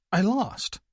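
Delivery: high small admission; sheepish. Acted. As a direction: admit it meekly. Mother admits loss.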